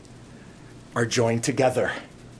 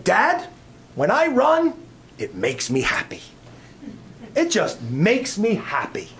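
put-on higher, lightly childlike little boy; a hint of a small boy speaking; voicing his little son; 5 semitones higher, slower than usual. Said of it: "He looked at me and he said".

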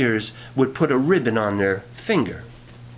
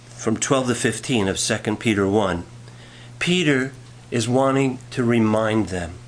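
ordinary delivery

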